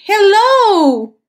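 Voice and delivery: put-on high, bright child's hello; a kid in a new school says hello; child greeting. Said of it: "los niños te dicen".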